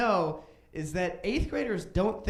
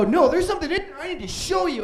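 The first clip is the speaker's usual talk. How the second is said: high, loud, laughing insistence; insistent yelling; 12 semitones higher, 8 dB louder, faster than usual. Retold or imitated. Kid insisting his sister come to the bathroom, loud and high. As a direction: shout it through a laugh. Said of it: "I go".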